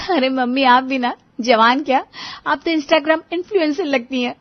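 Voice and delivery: butter her up cheekily; playful teasing. A narrator's reading. Riya joking with mother-in-law before guests, teasing.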